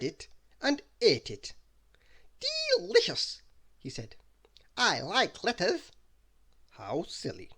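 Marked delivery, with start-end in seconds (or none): 2.8-3.4 silly voice. Acted. Mister Silly savouring. 4.8-5.9 high, slow goofy voice; dopey, innocent cartoon voice; silly character voice.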